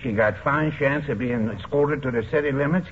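usual speaking voice

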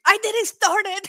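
high, drawn-out mock yell; mock scream. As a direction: yell it like a wrestler in a brawl. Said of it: "beating her up and screaming".

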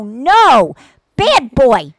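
loud firm scold; stern dog scold. Retold or imitated. Owner scolding a dog the old way, loud and firm. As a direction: tell the dog off sharply. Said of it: "you just used to go".

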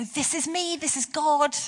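a mock fanfare of a divine announcement; trumpet announcement. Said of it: "get out a trumpet and go".

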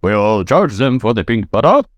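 put-on old radio announcer voice; 1950s newsreel delivery; Mid-Atlantic accent. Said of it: "weird mid-Atlantic, like, colonial, like, nineteen fifties radio fucking voice".

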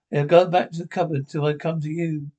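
ordinary talk

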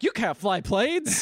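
slow, high mock movie line; mock dramatic. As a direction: ham it up like an old movie.

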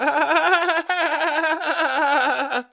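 high, sustained fake laugh; hammed-up forced laughter; Jason Bateman laugh. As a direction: rattle out a staccato cackle on one note. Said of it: "I'm just Jason Bateman over here".

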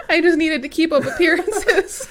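high, loud, giggly voice; laughing mock excuse. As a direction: play a sheepish dog through laughter. Continues the dog joke, laughing.

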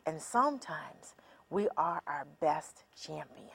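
"and sometimes we are our best champion" in a soft whisper. Shown as a demonstration. Speaker whispering the champion side to listeners. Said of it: "contrast critic vs champion".

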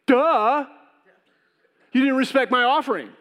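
put-on high, loud, whiny teenage duh; sulky duh. Petulant worshipper snapping back at God, high and whiny. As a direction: whine it back like a sulky kid. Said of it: "And we would say".

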